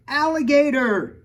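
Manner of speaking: high, loud shriek of alarm; screaming mom. A narrator's reading. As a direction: scream it in panic. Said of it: "my mom screamed".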